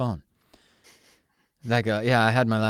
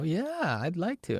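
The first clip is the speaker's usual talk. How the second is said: eager fake yes; 9 semitones higher, 4 dB quieter, slower than usual. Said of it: "and they go".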